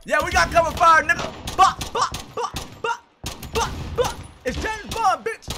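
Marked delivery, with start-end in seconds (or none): 1.6-4.1 high staccato mouth-made shots; mock gunfire. Player giving cover fire, high mouthed gunshots. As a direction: pop out high, separate gun bursts. Imitating gunfire during game.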